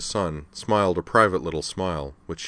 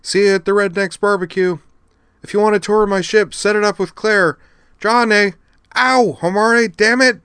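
very loud, much higher calls across a room; shouting after them; 9 dB louder than usual. Acted. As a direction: yell back while being hauled away. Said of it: "shouting after her".

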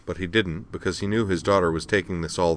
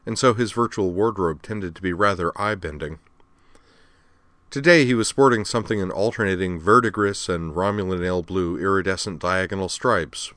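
ordinary delivery

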